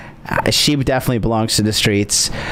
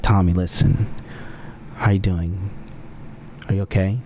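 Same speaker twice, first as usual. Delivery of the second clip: low, soft concerned voice; lightly played caring ex on the phone; smooth caring call; 6 semitones lower, 5 dB quieter than usual. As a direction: sweet-talk her on the phone, low and gentle. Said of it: "what I would do to her on the phone".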